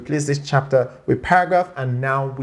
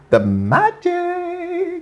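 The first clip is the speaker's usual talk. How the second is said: playful drawn-out; 13 semitones higher, much slower than usual. Real, not acted.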